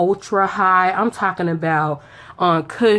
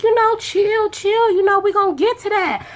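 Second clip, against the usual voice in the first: high, playful chill chill; high teasing voice; 10 semitones higher than usual. Retold or imitated. Telling him to slow down.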